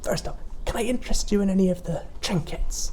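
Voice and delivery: put-on high, sly old shopkeeper voice; sweet but sinister seller; sly mysterious shopkeeper. Toy seller.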